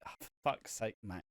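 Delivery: fed-up groan. Retold or imitated. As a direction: drop the voice, tired of him.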